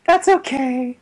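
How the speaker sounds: put-on high, small-child voice; imitated little girl. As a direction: play a forgiving little girl. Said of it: "the little girl's like".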